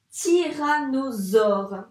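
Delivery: tyrannosaure slow and loud, each syllable leaned on; drawn out loudly. Shown as a demonstration. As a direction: boom out tyrannosaure to a child. Repeating word for child. (tyrannosaure lent et fort, bien détaché)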